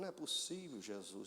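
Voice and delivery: quase sussurrando, voz caindo; espanto baixinho. Retold or imitated. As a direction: murmura incrédulo, perdendo o fôlego. Reação espantada ao médico. (sussurro incrédulo de alívio)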